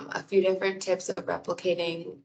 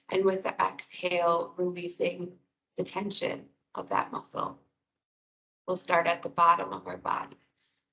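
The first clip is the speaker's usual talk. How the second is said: guided meditation. Real, not acted. Wellness coordinator guiding listeners through breathing and muscle release, guided meditation.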